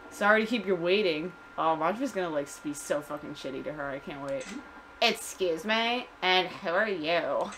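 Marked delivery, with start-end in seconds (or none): 5.0-7.5 put-on slow, affronted Valley Girl drawl. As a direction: stretch it like a snooty Valley Girl. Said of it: "like Valley Girl".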